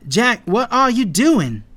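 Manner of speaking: high, loud, drawn-out question; slow, loud disbelieving shout; urgent call back. A narrator's reading. Calling back to stopped friend.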